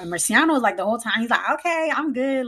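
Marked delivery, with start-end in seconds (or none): 1.5-2.5 lightly imitated kid, pitched up; breezy, higher-pitched little-boy reply; carefree child. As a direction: imitate a child who couldn't care less. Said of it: "he doesn't even care".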